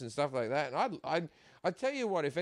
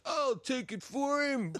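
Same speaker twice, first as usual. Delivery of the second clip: slow, higher shout from the nephew; mock shout; 8 semitones higher, slower than usual. Nephew grabbing the shot.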